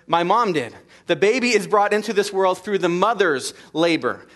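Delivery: impassioned sermon build; preaching at full force. Acted. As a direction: hammer it home from the pulpit.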